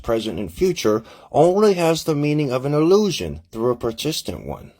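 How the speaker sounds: high-pitched put-on physicist voice; Albert Einstein. Physicist consoling his late friend's family, put-on character voice.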